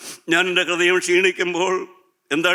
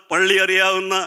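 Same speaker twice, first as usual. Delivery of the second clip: ordinary talk, nothing marked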